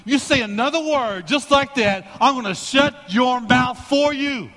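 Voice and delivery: furious dad telling off the heckler; angry confrontation. Retold or imitated. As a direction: warn the heckler to shut it, hard. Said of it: "looked at that guy and said".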